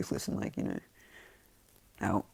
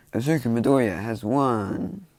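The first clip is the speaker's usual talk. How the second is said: slow, loud, drawn-out winner announcement; announcer; 3 semitones higher, 7 dB louder, slower than usual. Acted. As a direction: proclaim the winner to a crowd, stretching it out.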